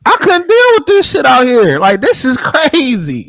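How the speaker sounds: loud incredulous rant; exasperated outburst. Real, not acted. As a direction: vent in disbelief.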